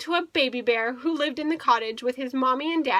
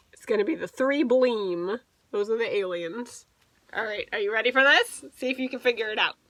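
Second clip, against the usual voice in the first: plain reading voice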